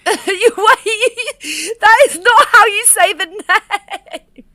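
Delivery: high, laughing disbelief, slowing at the end; laughing protest. Real, not acted. Friend laughing at how she says the name, high and disbelieving. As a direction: giggle through the objection.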